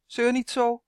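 brisk, irritated snap; impatient elf hurrying the boy. A narrator's reading. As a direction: cut the whining short. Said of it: "zoemde Uf ongeduldig".

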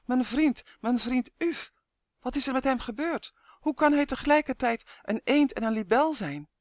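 high, agitated racing thoughts; joyful confusion. A narrator's reading. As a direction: wonder aloud, pitch lifted. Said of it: "verwarring, de gedachte duizelde". (blij verward, met hoge stem)

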